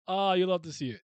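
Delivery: usual speaking voice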